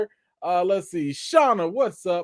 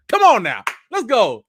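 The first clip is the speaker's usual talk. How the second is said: loud higher Come on now; hyped come on now; 7 semitones higher, 8 dB louder than usual. Real, not acted. Hyping up viewers in chat.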